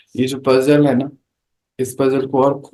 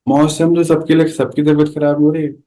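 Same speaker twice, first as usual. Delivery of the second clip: usual speaking voice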